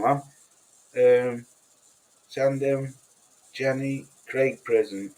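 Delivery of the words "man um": ordinary delivery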